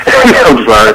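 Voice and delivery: ordinary talk, nothing marked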